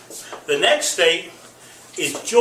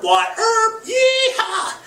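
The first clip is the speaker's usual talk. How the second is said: cowboy cheer; much slower than usual. Pretend. Host cheering his favorite lawman excitedly. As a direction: whoop for a gunslinger hero.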